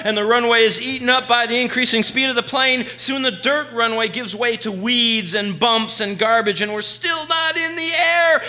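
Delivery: loud, high-pitched thrilling narration; excited storytelling. Retold or imitated. Teller recounts the overloaded plane's takeoff, loud and high-pitched. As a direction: tell the takeoff loudly, at a high pitch, as if living it.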